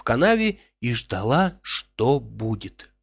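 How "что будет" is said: ordinary delivery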